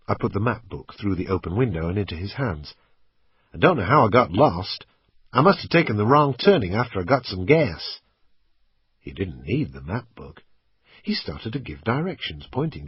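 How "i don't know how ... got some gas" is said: put-on lost motorist with a light American accent; lightly American, a little louder. A narrator's reading. Stranger explains getting lost, light American accent. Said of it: "My American accent was quite good".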